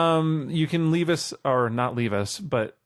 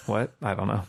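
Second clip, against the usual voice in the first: ordinary talk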